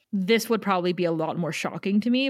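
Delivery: ordinary delivery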